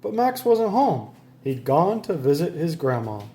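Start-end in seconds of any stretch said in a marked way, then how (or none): none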